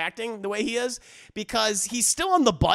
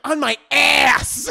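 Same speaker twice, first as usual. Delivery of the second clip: loud, drawn-out, higher demand; mock impatient shout; 6 semitones higher, 8 dB louder, slower than usual. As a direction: shout it slowly, hammed up. Wife barks where to slap.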